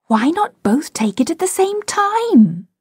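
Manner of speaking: put-on high, eager little-girl suggestion; girl's bright idea. A narrator's reading. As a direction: propose it keenly, pitched high. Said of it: "Wendy had a splendid idea".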